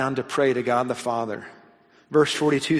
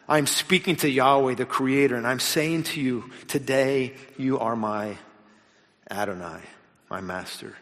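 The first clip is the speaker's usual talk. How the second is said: quiet prayer; 2 semitones lower, 4 dB quieter than usual. Shown as a demonstration. Preacher models a daily prayer to his congregation, soft and sincere. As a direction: pray it gently, as a personal surrender. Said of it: "be something you pray".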